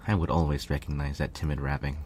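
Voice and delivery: ordinary delivery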